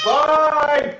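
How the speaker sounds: high, loud, drawn-out yell; shouted dismissal. Real, not acted. Parent dismissing the pestering child, shouted dismissal. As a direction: shout them out of the room.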